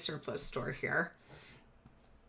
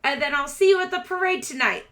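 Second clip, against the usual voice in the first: higher-pitched, chirpy little showman; brisk rat boy salesman; 7 semitones higher, 5 dB louder than usual. Acted. Rat boy selling play tickets to shoppers, high brisk patter. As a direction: wrap up the sale, keeping it high and brisk.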